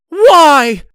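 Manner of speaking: piercing high shout, very loud. Acted. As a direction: scream it out. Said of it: "she shouted again".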